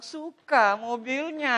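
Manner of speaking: slow, drawn-out impression of a wife; playfully stretched wifely admiration; wife imitation. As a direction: coo it slowly as an admiring wife. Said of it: "istri saya bilang".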